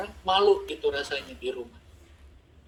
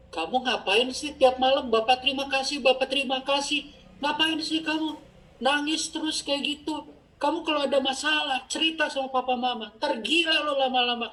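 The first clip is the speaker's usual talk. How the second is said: high-pitched scolding, all on a raised pitch; annoyed younger sibling; 10 semitones higher than usual. Retold or imitated. Sibling scolds praying brother every night, high annoyed complaint. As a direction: imitate an exasperated sibling, pitched way up. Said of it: "dia langsung bilang".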